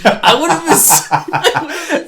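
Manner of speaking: high, loud words through laughter; laughing hard. Real, not acted. Fan pitching a silly portal scene, helpless laughter. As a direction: crack up at your own silly idea. Cracking up at own silly idea.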